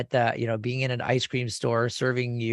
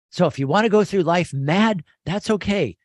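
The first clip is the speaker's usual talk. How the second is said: excited; 7 semitones higher, 6 dB louder than usual. Real, not acted. Enthusiastic host aside.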